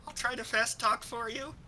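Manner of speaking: high squeaky little voice. Acted. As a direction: pitch it up, small and squeaky. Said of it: "convey the squeaky high voice".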